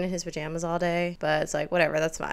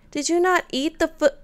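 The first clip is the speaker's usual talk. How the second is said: indignant; 10 semitones higher, 6 dB louder than usual. Real, not acted. Indignant at sim not eating.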